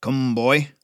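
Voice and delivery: put-on curt command from an old man; gruff old smith. A narrator's reading. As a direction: order the lad up, brusque. Old smith orders the boy.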